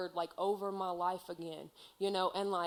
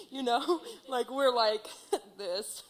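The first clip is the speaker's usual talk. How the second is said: laughing, higher and louder; bubbling over with real delight; laughing with joy; 5 semitones higher, 5 dB louder than usual. Real, not acted. Sharing her closeness to God, laughing happily.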